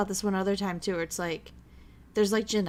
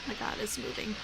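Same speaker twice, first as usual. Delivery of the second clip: soft whispered amazement; hushed whisper; 3 semitones higher, 5 dB quieter than usual. Real, not acted. Viewer whispering as something moves on screen. Reacting to the movie.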